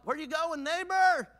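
high, rising neighborly call. Acted. Neighbor hailing the churchgoer on Sunday night, calling out.

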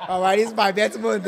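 laughing. Real, not acted. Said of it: "don't correct me".

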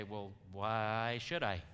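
slow, drawn-out WHY; reluctant questioning. Acted. Someone asking why bother improving, reluctant and slow. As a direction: drag out a mildly reluctant question.

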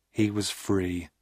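ordinary delivery, nothing marked

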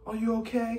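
high, put-on softness; sweetly high, mocking his fake gentleness; mock soft voice. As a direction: imitate a guy going soft around a woman. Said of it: "you get all soft and".

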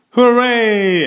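high, loud cheer; a drawn-out, high-pitched hooray; cheering shout. A narrator's reading. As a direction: shout it out like a delighted class. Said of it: "everyone shouted".

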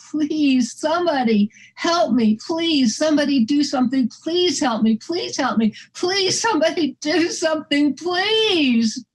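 acted tearful scream for help, held back in volume; retold crying plea. Dog owner begging a beach crowd for help, crying plea. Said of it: "I scream to them, and I'm crying".

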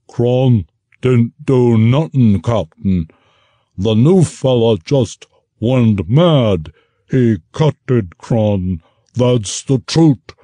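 wounded ogre lying to the captain, slow and clumsy; dim ogre. Acted. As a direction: plead like a big dull brute. Ogre voiced in story.

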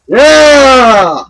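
long, high, loud cheer; triumphant yell. Real, not acted. Player celebrating a kill as time runs out, triumphant yell. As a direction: yell it out, held and falling at the end.

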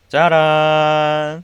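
very slow, stretched fanfare; drawn-out ta-da. Pretend. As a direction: drag out 짜란 like a reveal. Revealing daily earnings.

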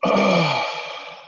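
a long drowsy sigh, playing asleep; groggy waking sigh; mock sleepy sigh. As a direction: sigh like you just woke up. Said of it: "doing my best Bucks impersonation".

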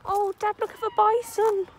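loud excited call to Dad. Real, not acted. Visitor calling Dad to look at the bison, excited calling out.

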